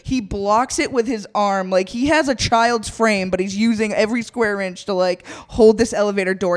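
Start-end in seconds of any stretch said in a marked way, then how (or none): none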